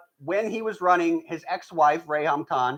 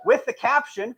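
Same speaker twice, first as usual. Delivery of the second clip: ordinary delivery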